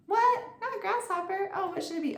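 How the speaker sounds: high squeak of fake shock; mock surprise. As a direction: pretend to catch the silly word. Mock correction for kids.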